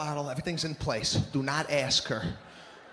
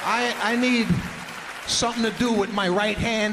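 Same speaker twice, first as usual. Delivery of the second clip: emphatic plea; 3 semitones higher, 5 dB louder than usual. Retold or imitated. Comic pleading for bread.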